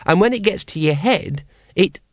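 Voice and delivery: put-on high child's voice, cut off; happy child. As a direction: carry on gleefully like a small child. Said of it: "said the child happily".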